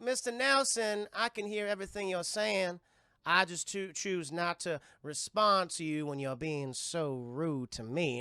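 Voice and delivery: slow Southern reproach; Zelda's Southern accent. Retold or imitated. Seer chiding the father who whispered about her, Southern drawl. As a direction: scold with a drawn-out Southern lilt. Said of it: "a very thick Southern accent".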